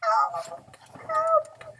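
high, loud cry from upstairs; crying for help. Acted. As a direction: shout for help from far above. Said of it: "cried a voice from up above".

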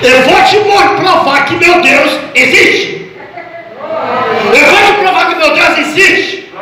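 high, stretched preacher's vow; defiant declaration. Retold or imitated. Preacher's retold answer to his doubting son-in-law, defiant declaration. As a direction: declare it with slow, ringing conviction.

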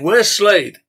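the whole bar-room crowd yelling for Slade. Acted. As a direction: roar it like an angry mob. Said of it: "The crowd yelled out again".

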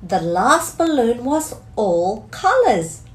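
ordinary delivery

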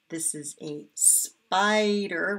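slow naming. Shown as a demonstration. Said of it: "teacher naming picture slowly".

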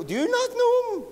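very high, squeaky incredulous question; imitated young lassie. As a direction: mimic an astonished girl, pitch shooting right up. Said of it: "three young lassies saying".

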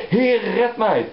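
a sinking man's cry to the Lord; Peter crying out. Acted. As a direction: call out as if sinking. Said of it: "En hij schreeuwde".

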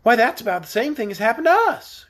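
lightly voiced eager child; excited girl. A narrator's reading. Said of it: "cried Trot".

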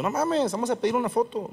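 high, starstruck exclamation; excited fan. Retold or imitated. Comedian hearing a star was there, fan excitement. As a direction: jump at the chance, voice shooting up. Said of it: "yo todavía en plan fan".

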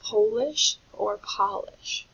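POLISH slowly with long O, then with short ah; two vowel choices. Shown as a demonstration. Teacher asking which word fits, slow contrasting pronunciations. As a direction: say POLISH as poh-lish, then pah-lish. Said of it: "not referring to the culture".

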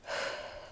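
real exhausted sigh; tired sigh. Real, not acted. Worn out the night after surgery, tired sigh. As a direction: let out a soft, weary breath.

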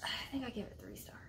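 whispered confession; hushed, sheepish admission. Real, not acted. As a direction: whisper it like a guilty secret. Admitting a low rating.

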